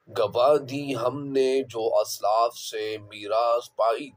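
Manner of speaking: slow, measured poetry recital; verse recitation. Acted. Teacher reads verse number eight to students, slow poetic recitation. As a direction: recite the couplet slowly, drawing out the words.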